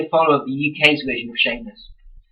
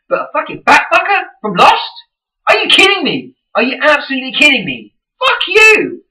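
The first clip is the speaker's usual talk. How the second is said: high, loud outraged yelling; furious rant; 11 semitones higher, 4 dB louder than usual. Retold or imitated. Fan ranting at the Lost actor, outraged yelling. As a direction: blow up at him in disbelief.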